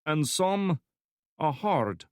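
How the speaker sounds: put-on cheerful threat, thick Slavic accent; heavy Slavic accent. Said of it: "speaking in a heavy Slavic accent".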